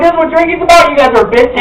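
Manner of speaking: acted boozy bravado; rowdy drinker's boast. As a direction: crow it loudly, playing a first-time drinker. Acting out drunken bragging.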